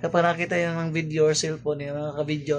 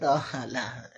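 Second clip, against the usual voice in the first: ordinary delivery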